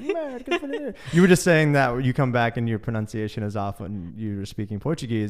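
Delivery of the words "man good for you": much higher mock Southern drawl; teasing a local twang. Shrugging off a compliment in a put-on drawl. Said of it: "I'm like".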